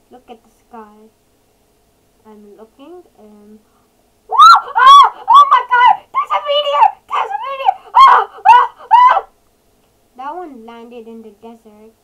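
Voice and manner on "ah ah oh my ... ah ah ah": high, piercingly loud repeated screams; panicked screaming. Real, not acted. Player seeing a meteor fall from the sky, screaming. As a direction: scream in full alarm as it comes down.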